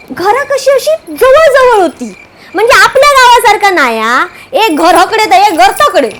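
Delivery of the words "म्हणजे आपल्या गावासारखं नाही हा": ordinary delivery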